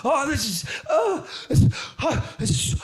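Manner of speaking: high, loud relieved moans; mock bliss. Stoned man in cold shower, hammed-up high bliss. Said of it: "sitting going".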